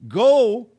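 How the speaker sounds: "Go" shouted out loud and high; emphatic go. Real, not acted. Preacher urging home congregation to go, loud emphatic "Go". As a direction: hit "Go" hard like a command. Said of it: "I like the emphasis on go".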